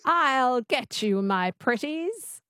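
slow, twangy witch attempt; Wicked Witch. Shown as a demonstration. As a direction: copy the coach's witch twang, drawn out. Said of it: "Just say that".

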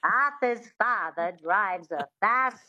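slow transatlantic accent with a witchy twang. Shown as a demonstration. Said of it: "that twang is really important".

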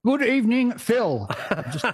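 hammed-up old-time proclamation voice; Sir Douglas Mawson. Podcast guest greeting the host, imitating a polar explorer's proclamation voice. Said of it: "my Sir Dougie Mawson voice going".

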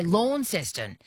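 "Launceston" said "LAWN-ses-ton"; "Launceston" with the disliked AW vowel; mispronounced Launceston. Shown as a demonstration. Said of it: "drives me crazy when people say".

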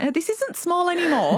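high, half-laughing mock panic; mock alarm. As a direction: squeak it out like the penny just dropped. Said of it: "but I was like".

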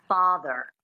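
American Father with the final r pronounced; standard American. Shown as a demonstration. Coach repeating the example for a learner, standard American accent. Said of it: "standard American".

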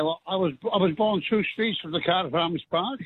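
ordinary talk, nothing marked

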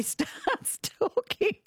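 real amused laughter between phrases; laughing through words. Real, not acted. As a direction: tell it while cracking up. Laughing while retelling the clip.

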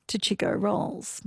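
ordinary talk, nothing marked

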